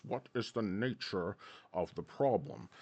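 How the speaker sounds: a British boss demanding an answer; British accent. Retold or imitated. The boss questions the young man, lightly British. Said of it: "stop with the accent".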